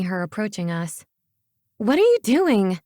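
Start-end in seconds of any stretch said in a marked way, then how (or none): 1.8-2.8 put-on high, loud girl's demand; indignant higher voice. A narrator's reading. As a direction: demand an explanation, pitched up. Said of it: "she demanded".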